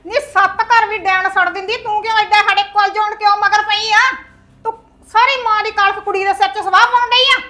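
loud, furious scolding; angry tirade. Acted. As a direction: berate her relentlessly. Mother berating daughter at length.

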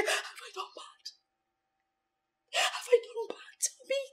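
hushed, tearful whisper; whispered sobbing. Acted. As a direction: whisper it through tears. Whispered crying.

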